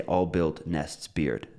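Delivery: All built nests beard, slow and stressed, the rest dropped; only content words. Shown as a demonstration. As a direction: say just All built nests beard, each word hit. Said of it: "You could even say".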